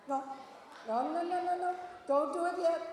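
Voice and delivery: loud, quick-fire no's, then a firm warning; urgent halting. Real, not acted. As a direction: stop the eager crowd, loudly. Halting audience about to scream early.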